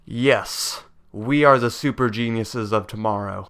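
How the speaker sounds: usual speaking voice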